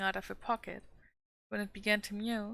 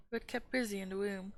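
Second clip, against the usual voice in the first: ordinary delivery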